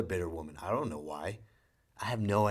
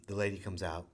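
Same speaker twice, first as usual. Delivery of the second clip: ordinary delivery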